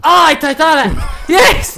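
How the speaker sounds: loud high delighted cheer; excited shout. Real, not acted. Excited agreement.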